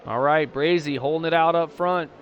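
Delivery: live play-by-play. Real, not acted. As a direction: keep the excitement up calling the action.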